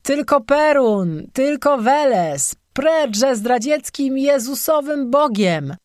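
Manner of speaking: high, slow, stretched-out rallying cry; drunken bellowing. A narrator's reading. Drunk pagan declaims his gods to the tavern, bellowing. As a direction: holler the slogans high and drawn out. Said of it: "zaczął się bardzo donośnie drzeć".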